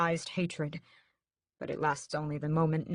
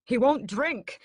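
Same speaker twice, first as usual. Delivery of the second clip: urgent; 8 semitones higher, 7 dB louder, slower than usual. A narrator's reading. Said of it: "I say".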